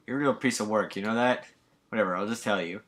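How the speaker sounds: ordinary talk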